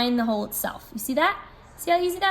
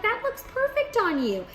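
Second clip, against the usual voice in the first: much higher, eager praise for a customer; eager salesperson compliment; 10 semitones higher than usual. Shown as a demonstration. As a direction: compliment it like a delighted shop clerk. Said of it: "Be like".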